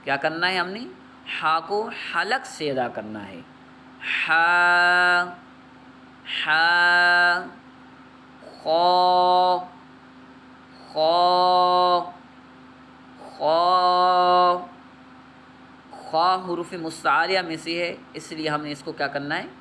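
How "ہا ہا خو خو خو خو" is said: خو said heavy and full-mouthed; خو read heavy, a little louder; heavy letter. Shown as a demonstration. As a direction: give خو a thick, heavy sound. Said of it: "موٹا پڑھنا ہے".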